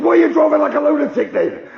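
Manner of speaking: high, loud outraged shout; furious copper; angry cop. Retold or imitated. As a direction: yell the accusation.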